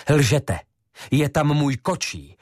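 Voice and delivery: high, loud, indignant outburst; angry accusation. Acted. Ivo accusing Josef Šafar of lying, angrily. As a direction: throw the lie back in his face.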